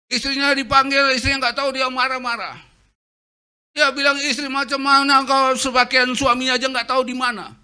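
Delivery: loud, high furious ranting; angry shouting father. Retold or imitated. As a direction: rage through the house yelling. Angry father calls everyone.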